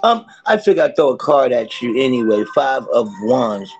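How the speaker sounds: loud, lively tarot reading; upbeat tarot reading. Acted. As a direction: deliver the card of the day.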